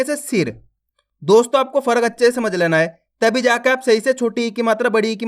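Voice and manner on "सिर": सिर with a short, unstretched i; सिर said briefly, short i; short i demo. Shown as a demonstration. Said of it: "तो अपन कम खींच के पढ़ेंगे".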